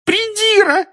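very high, loud exclamation; shrieked, derisive amusement; amused scoff. A narrator's reading. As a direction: cry it out as if laughing at it. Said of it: "выговорила она со смехом".

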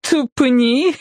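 high, tittering lady's voice; mock them in a high tipsy voice; drunken woman about to crack up; giggly drunk woman. A narrator's reading. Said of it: "прыснула со смеху женщина".

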